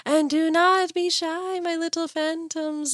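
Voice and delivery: high, slow, eerie outro voice; hammed-up ghostly announcer reading the sign-off; spooky ghost host. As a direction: croon to the little phantoms, playfully haunting.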